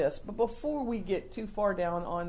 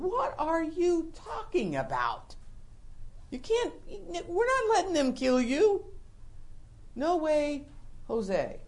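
protesting Peter; 7 semitones higher than usual. Retold or imitated. Peter objecting to Jesus, high pleading protest. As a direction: push back, voice up high. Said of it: "he's going".

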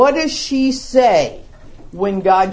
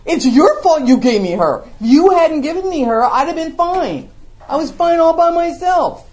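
higher and louder, whining excuse; whiny blame; 8 semitones higher, 6 dB louder than usual. Retold or imitated. Adam whining his blame at God, higher and louder. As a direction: sulk and point at God. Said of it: "Who is Adam blaming? God first".

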